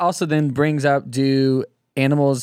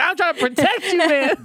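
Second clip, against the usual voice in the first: much higher, laughing cartoon donkey; squeaky donkey pleading through a laugh; Shrek's Donkey voice. Donkey protecting Balaam from the angel, high laughing squeak. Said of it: "talking like Donkey Kong Shrek".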